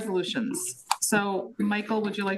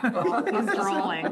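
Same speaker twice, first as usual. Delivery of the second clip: laughing; much slower than usual. Real, not acted. Laughing joke at late meeting.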